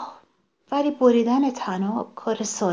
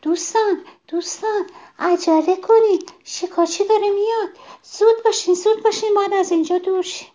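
much higher, louder alarmed cry, speeding up toward the end; worried shout; 12 semitones higher, 4 dB louder than usual. A narrator's reading. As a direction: shout a frantic warning to friends as the hunter comes. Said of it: "با نگرانی فریاد زد".

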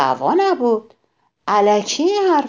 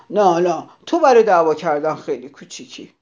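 fast, louder hurried retort; said hastily; 2 semitones lower, 4 dB louder, faster than usual. Acted. Grouchy ladybug hastily brushes off the wasp, fast. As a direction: blurt it out to escape. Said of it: "تندی گفت".

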